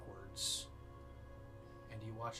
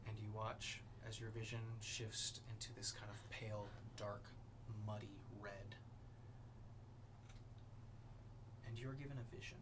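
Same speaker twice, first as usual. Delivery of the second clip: ominous narration; 3 dB quieter than usual. A narrator's reading. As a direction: paint the vision with dread. GM dark scene narration.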